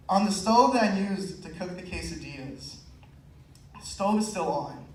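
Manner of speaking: ordinary delivery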